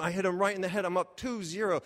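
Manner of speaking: ordinary talk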